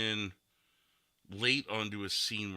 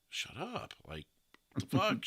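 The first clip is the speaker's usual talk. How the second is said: higher, exasperated mock outburst; mock shut-up character; 5 semitones higher than usual. Host imitating Nate's character shutting a friend up, mock outburst. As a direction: imitate the character telling someone to shut up, pitched up. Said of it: "sometimes at points goes".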